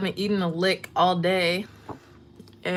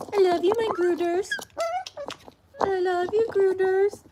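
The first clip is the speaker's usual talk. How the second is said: put-on much higher cooing baby talk; high pet voice; 12 semitones higher than usual. Owner telling her dog she loves him, cooing. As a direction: coo it to the puppy.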